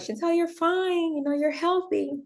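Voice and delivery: pat her off gently as a breezy doctor; dismissive doctor. Retold or imitated. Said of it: "they're like".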